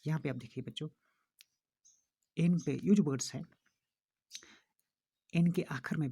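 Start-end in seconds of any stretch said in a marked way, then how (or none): none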